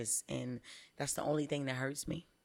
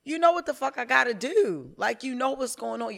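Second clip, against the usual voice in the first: higher, louder exasperated rant; retold furious yell; 8 semitones higher, 7 dB louder than usual. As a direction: blast the coach for not getting it. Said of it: "that shit make me so mad".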